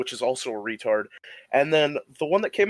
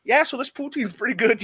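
put-on Yooper accent; 11 semitones higher than usual. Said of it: "So say it how you said to her".

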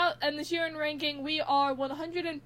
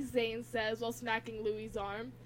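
usual speaking voice

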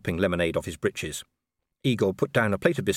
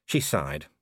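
plain reading voice

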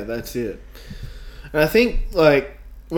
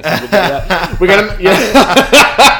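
slow words through laughter; laughing; 8 semitones higher, 9 dB louder, slower than usual. Real, not acted. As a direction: trail off laughing. Laughing about the intro.

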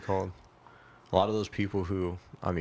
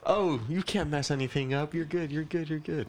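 higher-pitched, sing-song coddling; mock reassurance; 6 semitones higher than usual. Voicing overpraisers to the spoiled customer, mock-reassuring. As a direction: imitate someone endlessly reassuring, pitched up.

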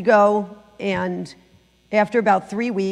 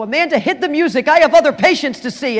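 higher and much louder, a hammed-up bossy nurse; imitating the nurse; 7 semitones higher, 9 dB louder than usual. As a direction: play the funny nurse barking orders, loud and high. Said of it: "he'd go".

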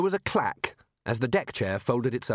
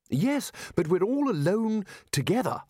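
higher, urgent insistence; 10 semitones higher than usual. A narrator's reading. Ridcully worries about being alone with Missus Whitlow, urgent. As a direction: press the point urgently, pitch raised. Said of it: "said Ridcully urgently".

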